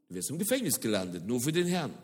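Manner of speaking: normal talking voice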